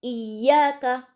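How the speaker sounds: इय्याका with a light glottal catch on the opening ई; इय्याका said slowly, the ई clipped with a gentle glottal jolt. Shown as a demonstration. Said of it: "हमज़ा ज़ेर ई को हल्के से झटके के साथ में".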